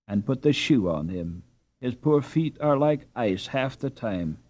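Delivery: usual speaking voice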